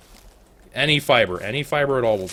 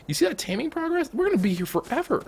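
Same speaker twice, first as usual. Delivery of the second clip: high mock complaint. Real, not acted. Complaining about slow taming.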